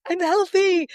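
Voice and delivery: high, loud, cheerful; upbeat self-affirmation; bright affirmation. Retold or imitated. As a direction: chirp it like a mantra. Affirmation to alarm.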